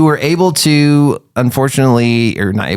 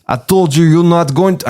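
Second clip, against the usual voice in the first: put-on vague Swedish-Hungarian accent, higher pitched; failing European accent; 7 semitones higher than usual. Rival taunting the player after the race, wobbly Swedish-Hungarian accent. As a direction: attempt a European accent and lose it. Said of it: "I can't even do this accent anymore".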